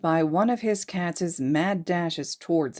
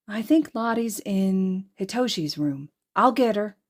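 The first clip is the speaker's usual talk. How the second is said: put-on young girl voice; 5 semitones higher than usual. A girl's voice in the story.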